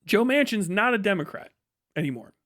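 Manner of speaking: usual speaking voice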